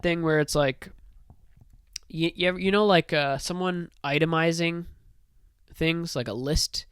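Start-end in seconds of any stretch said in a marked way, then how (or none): none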